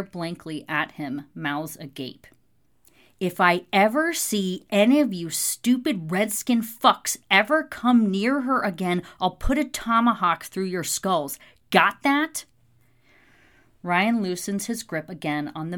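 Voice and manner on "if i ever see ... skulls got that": loud, raised-pitch warning; furious threat. A narrator's reading. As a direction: threaten them hard, punching the words out. Marshal threatens men in bar fight.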